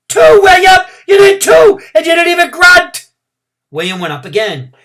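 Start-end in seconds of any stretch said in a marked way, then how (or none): 0.1-3.1 overjoyed shout, much higher and louder; excited cheering. A narrator's reading. Girl cheering William from the grass, ecstatic shout. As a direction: jump up and cheer him on.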